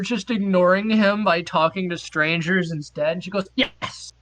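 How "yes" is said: whispered yes. Retold or imitated.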